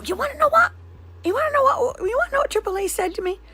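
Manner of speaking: very high-pitched exasperated lead-in; worked-up venting. Real, not acted. Venting to viewers about what triple A said, high-pitched exasperation.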